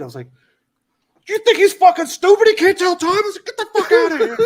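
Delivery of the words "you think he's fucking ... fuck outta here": much higher and louder, furious mock yelling; retold angry yell. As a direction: bark it at a subordinate who said something stupid. Retold dressing-down of a soldier.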